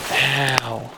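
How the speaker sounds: real yelp of sudden pain; burnt fingers. Real, not acted. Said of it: "I burnt my fingers".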